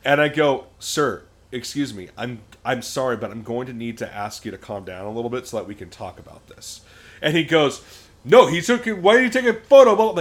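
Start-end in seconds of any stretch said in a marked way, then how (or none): 0.8-6.8 measured, de-escalating professional voice; lightly acted social worker, polite and controlled; trauma-informed social worker. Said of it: "full trauma-informed social worker". 8.2-10.2 loud, high-pitched mock outburst; raised retold tirade, pitched way up; imitated angry yelling. Retold angry man interrupting the calming.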